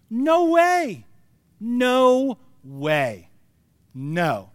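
loud, high, drawn-out dismissal; emphatic mock incredulity; scoffing disbelief. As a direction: refuse to believe a word of it. Sarah's scoffing inner response, acted.

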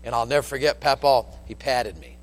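usual speaking voice

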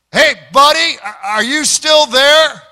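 put-on husband yelling out into the darkness for the drunk. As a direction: call out into the night for the stranger. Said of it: "so he yelled out in the darkness".

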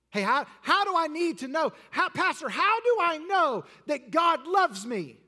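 preacher acting a churchgoer loudly asking the pastor for proof; pleading questioner. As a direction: cry out like someone begging for proof. Preacher voices a doubting questioner.

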